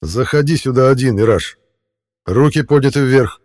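loud commanding shout. Acted. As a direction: bark the order to come in. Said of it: "крикнул он".

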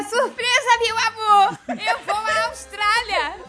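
high, sing-out mock excitement; gleeful surprise announcement. Mom springing a trip on dad, high showy excitement. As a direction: announce it like a hammed-up big reveal.

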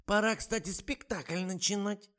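высокий, медленный старушечий голос; ласково уговаривает, как подслеповатая старушка; болтливая старуха. A narrator's reading. (высокий тягучий голос старой актрисы)

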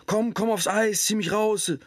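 high, pleading cry for help; drowning man calling from the ice. Retold or imitated. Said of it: "Aber der Mann ruft".

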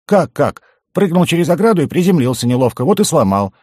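high, loud, matter-of-fact explanation; squeaky goblin, unperturbed; unfazed goblin. A narrator's reading. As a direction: explain the obvious in a piping voice. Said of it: "невозмутимо ответил гоблин".

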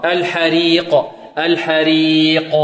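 الحريق with a light, thin kasra raa. Shown as a demonstration. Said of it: "هنا مكسورة ترقق". (ترقيق الراء في الحريق)

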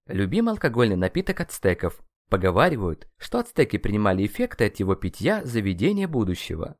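ordinary delivery

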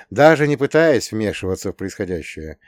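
plain reading voice

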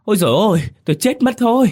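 high, loud shriek of pain; wife scalded in the shower shrieks in pain; scalded scream. Acted. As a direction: shriek as boiling water hits her. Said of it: "khiến cô vợ hét toáng lên".

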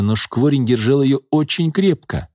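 plain reading voice

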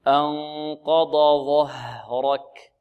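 أنقض ظهرك very slow, heavy letters distinct; careful tajweed recitation. Shown as a demonstration. Teacher making it clear to students, slow careful recitation. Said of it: "واضح؟".

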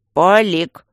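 loud, falling one-word reprimand; sharp bark. A narrator's reading. Guardian cuts off his indiscreet colleague, barking. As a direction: bark the name to shut him up. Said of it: "рявкнул Аристарх".

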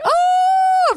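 very high, loud mock scream; hammed-up panicked "oh" shriek; freaked-out wail. Acting out freaking out on drugs.

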